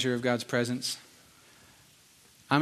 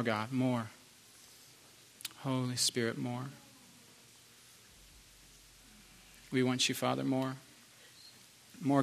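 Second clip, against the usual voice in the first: murmured prayer; 2 semitones lower, 7 dB quieter than usual. Acted. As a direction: linger on each more like a sigh. Soaking prayer, repeated pleas.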